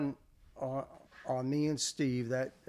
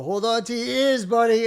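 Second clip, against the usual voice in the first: high, loud retold combat yell; shouted warning; 7 semitones higher, 7 dB louder than usual. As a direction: call it out to your buddy.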